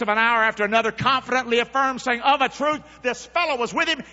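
loud, high preaching read; preaching scripture. Acted. Preacher reading Peter's denial to church, loud. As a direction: preach the passage with fire.

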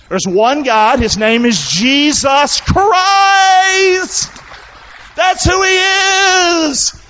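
high, loud shouted proclamation; full-force preaching. Real, not acted. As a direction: shout it to the congregation, stretching key words.

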